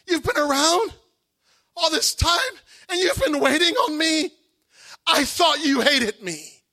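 loud, high disbelieving shout; incredulous outcry. Retold or imitated. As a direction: demand it of God, stunned. Shouting back at God.

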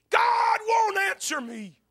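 high, slow, drawn-out wail of complaint; desolate cry. Acted. Preacher as Job abandoned by God, desolate cry. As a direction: cry it out slowly to heaven. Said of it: "the cry of this desolated man".

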